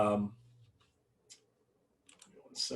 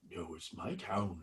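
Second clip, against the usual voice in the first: lower, soft, eerie voice on my town; 6 semitones lower, 10 dB quieter than usual. Acted. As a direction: drop it low and haunting. Possessed voice answers low.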